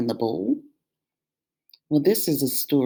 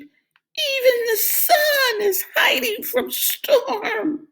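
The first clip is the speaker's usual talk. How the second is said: bleating sheep; slower than usual. A narrator's reading. Sheep despairs at the dark sky. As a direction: wail like a scared lamb.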